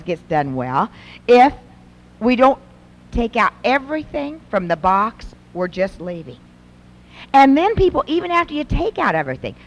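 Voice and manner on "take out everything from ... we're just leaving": normal talking voice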